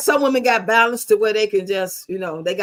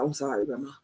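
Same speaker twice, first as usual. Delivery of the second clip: much softer and lower, a sheepish little sorry; meek apology; 8 semitones lower, 10 dB quieter than usual. Retold or imitated. Grandson apologizing to grandma, quiet and meek. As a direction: shrink down like a told-off kid. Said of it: "he be like".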